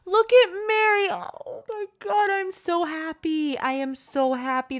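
high, loud and tearful; overjoyed. Real, not acted. Seeing Mary again, gushing high and near tears. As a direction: gush with relief and joy.

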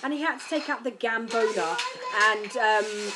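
normal talking voice

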